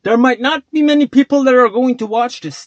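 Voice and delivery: ordinary talk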